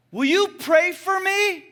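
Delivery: high, slow yell with words held long; yelled plea. Retold or imitated. Boy on the rock asking the preacher for prayer, yelling. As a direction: shout the request across the water. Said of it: "He yelled it".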